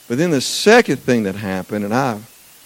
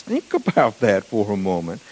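ordinary talk, nothing marked

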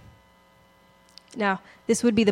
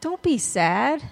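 dismissive comforter; 5 semitones higher, much slower than usual. Retold or imitated. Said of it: "they say".

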